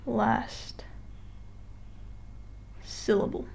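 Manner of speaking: whispering word by word, long pause between; hushed, voiceless aside; whispered. Real, not acted. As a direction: whisper it slowly to yourself as if noting it down. Teacher murmurs words while writing.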